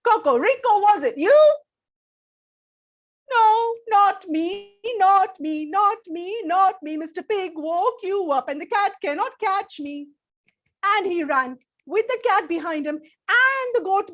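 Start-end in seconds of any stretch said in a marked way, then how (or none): 0.1-1.5 angry cow. Acted. Miss Cow jolted awake, shouting at Cocorico. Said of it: "Miss Cow woke up with a start". 3.3-10.1 higher, louder, slow not me; protesting rooster. Acted. Cocorico protesting to Miss Cow, loud and insistent.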